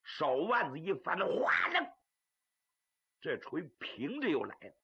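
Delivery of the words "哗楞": sudden higher swinging-weapon noise; imitated chain rattle. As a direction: voice the hammer whirling round. Imitates hammer swinging round.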